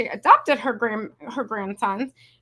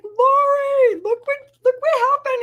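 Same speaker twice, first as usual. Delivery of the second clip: high, loud squeal of excitement; excited; 6 dB louder than usual. Retold or imitated. Telling assistant Lori about her craft, squealing high and excited. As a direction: squeal it out to show off. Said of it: "I was like".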